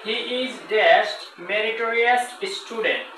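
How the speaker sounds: very slow, word by word, pausing at the blank; slow teacher dictation; slow example reading. Shown as a demonstration. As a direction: dictate the sentence slowly to the class. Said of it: "আবার দেখেন".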